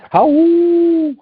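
high, loud howl like a wolf. Pretend. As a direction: throw back your head and howl. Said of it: "You gotta give me a wolf howl".

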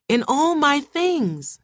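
high, slow drawn-out wail; screaming. A narrator's reading. Terrified wife repeats what she saw, high slow wail. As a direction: wail it, stretching the words. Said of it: "she was screaming again".

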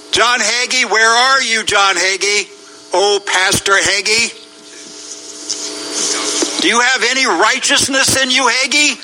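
high-pitched, accusing challenge to absent pastors; demanding public reproach; indignant calling out. Real, not acted. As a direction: call them to account, pitch held high.

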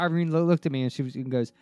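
ordinary talk

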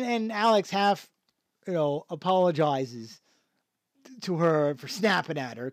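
ordinary talk, nothing marked

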